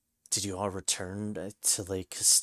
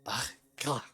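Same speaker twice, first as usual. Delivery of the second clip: horrified cry; 4 semitones higher than usual. Acted. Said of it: "recoiled in horror".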